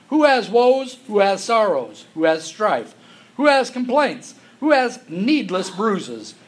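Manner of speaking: preaching the verses at full voice; forceful scripture reading. Acted. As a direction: proclaim the warning to the congregation. Reads Proverbs with force.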